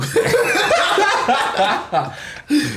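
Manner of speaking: high, loud-ish cackling laugh; laughing hard. Real, not acted. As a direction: crack up at your own jab. Cracking up at own jab.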